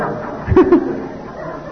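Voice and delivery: short breathy laugh; a quick real chuckle. Real, not acted. Laughs recalling brother's call.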